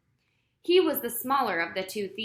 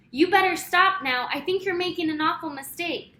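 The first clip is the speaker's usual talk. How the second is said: called out loud over a motor; raised voice over the roar of an engine; calling down; 7 semitones higher, 6 dB louder than usual. A narrator's reading. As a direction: shout a warning down from the truck top. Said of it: "he called down".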